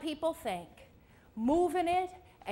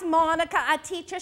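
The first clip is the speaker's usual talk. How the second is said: ordinary talk, nothing marked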